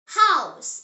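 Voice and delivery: teacher model, House with a strong H; clear model word. Shown as a demonstration. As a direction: show the breathed H in House. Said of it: "Say it".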